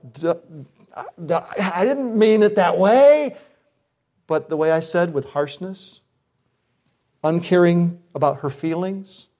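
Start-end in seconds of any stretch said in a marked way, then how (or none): 0.1-3.4 mock dumb self. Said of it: "I'm going".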